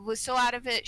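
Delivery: normal talking voice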